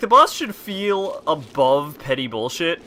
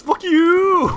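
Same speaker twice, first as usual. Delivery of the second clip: hammed-up high, loud boss wail; hilariously overacted; 9 dB louder than usual. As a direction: overact it wildly, YOU stretched and soaring. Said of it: "most hilariously overacted statement ever".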